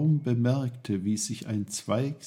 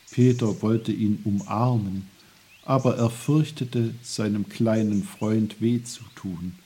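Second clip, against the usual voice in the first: plain reading voice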